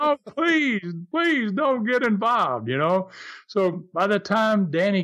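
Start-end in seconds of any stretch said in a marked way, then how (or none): 0.0-2.7 high, drawn-out mock pleading yell; retold scream. Teller begging his friend to stay out of it, retold scream. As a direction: imitate a desperate plea, slowing at the end. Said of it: "I'm screaming just as loud".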